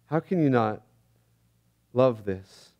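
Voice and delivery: normal talking voice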